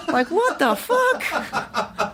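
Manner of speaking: high, slow, laughing what the; stretched out in laughing shock; laughing disbelief. Real, not acted. Actor reacting to his own slip, laughing disbelief. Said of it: "reacting to his own slip".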